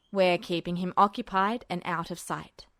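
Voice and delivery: low, hushed officer's voice; Mustang voiced low. A narrator's reading. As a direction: keep it low and secretive. Mustang's voice in story.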